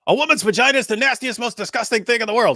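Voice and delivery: high, fast, loud imitation; hammed-up high-pitched outburst; mock disgusted rant. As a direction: rattle it off as an exaggerated tirade. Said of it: "this clip when he goes".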